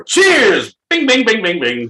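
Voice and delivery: loud cheerful toast; excited toast. Real, not acted. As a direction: cheer and ding the glass. Toast before the shot.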